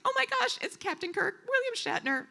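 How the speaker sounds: high-pitched, thrilled fan squeal; starstruck gush. Retold or imitated. Fan spotting Captain Kirk in the crowd, thrilled squeal. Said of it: "I was like".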